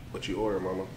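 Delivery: ordinary delivery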